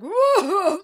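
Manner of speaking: high, loud jolly Santa whoop; Santa Claus voice. Retold or imitated. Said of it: "I will do his Santa Claus voice".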